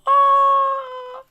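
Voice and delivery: long, loud, high-pitched aww; high sad aw. Real, not acted. Streamer sadly sighs as her guest leaves. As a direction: sigh out a disappointed aww. Reacting to goodbye.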